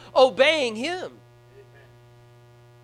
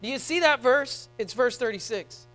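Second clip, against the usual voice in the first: louder, higher preaching at full force, with slow emphatic peaks; fervent preaching; 5 semitones higher, 6 dB louder than usual. Acted. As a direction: preach it hard to the congregation.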